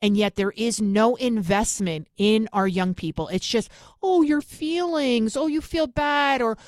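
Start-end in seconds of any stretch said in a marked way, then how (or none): 4.0-6.4 mocking coddling voice. Mocking coddling parents, sarcastic quote.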